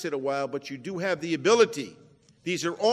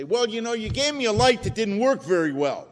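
mock complaint to God; 5 semitones higher, 8 dB louder than usual. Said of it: "go up to God and say".

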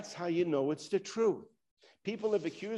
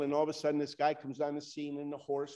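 normal talking voice

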